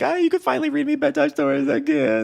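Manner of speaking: put-on teen daughter speaking like a small child; daughter's voice. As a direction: squeak it like a little girl. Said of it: "his daughter is like".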